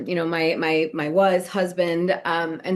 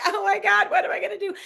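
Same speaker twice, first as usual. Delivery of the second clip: much higher, worried panic; panicked; 12 semitones higher, faster than usual. Retold or imitated. Wife panicking over the move, high worried voice. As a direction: spiral into worry.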